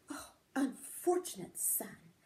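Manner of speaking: whisper. Acted. Mother's hushed reply.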